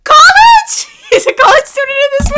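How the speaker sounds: high, loud disbelieving laugh; incredulous laughing shout; shocked laughing yell. Real, not acted. Surprised laughing outburst.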